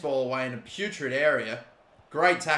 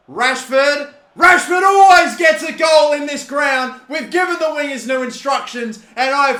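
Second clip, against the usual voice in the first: shouting the striker's name, much higher and louder; excited shout; 10 semitones higher, 11 dB louder than usual. Real, not acted. Gamer cheering his striker on, excited yelling. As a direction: yell it like he's about to score. Goal chance in football game.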